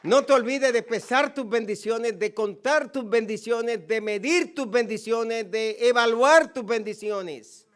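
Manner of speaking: loud, ringing sermon build; full-force pulpit exhortation; fiery preaching. Acted. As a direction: preach it out hard to the congregation. Preaching crescendo on blessings.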